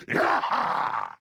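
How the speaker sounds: high, wild granny war whoop; battle whoop. Acted. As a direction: let out a gleeful "ready to chop" yell. Battle cry before going chopping.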